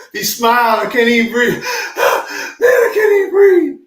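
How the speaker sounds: high, loud laughing with a gasp for air; squeaky wheezing laughter; breathless laughter. Retold or imitated. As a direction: laugh so hard you can't get your breath. Laughing so hard, can't breathe.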